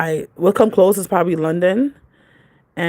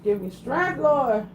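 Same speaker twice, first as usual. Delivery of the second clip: praying for patience through teeth; 7 semitones higher, 5 dB quieter, much slower than usual. Real, not acted. Exasperated with child, praying.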